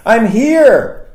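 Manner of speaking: high, loud call into the house; a loud, eager announcing call; shouted arrival. Retold or imitated. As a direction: shout it up the stairs, hopeful. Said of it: "and shouted".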